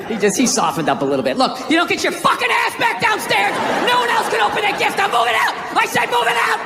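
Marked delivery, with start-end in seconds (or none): 1.4-6.6 put-on angry toddler yell. Toddler yelling threats upstairs.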